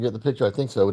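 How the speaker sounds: usual speaking voice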